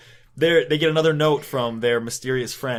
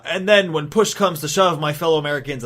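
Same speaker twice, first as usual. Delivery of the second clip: raised, declamatory mock oratory; politician's speech; 3 semitones higher, 6 dB louder than usual. Candidate finishing his speech to supporters, mock oratory. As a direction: orate it like a stump speech.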